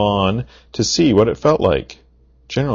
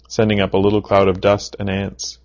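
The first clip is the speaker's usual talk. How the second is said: ordinary delivery, nothing marked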